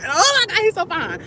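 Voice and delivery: high squeal of delight. Real, not acted. Fangirling to viewers, high squeal.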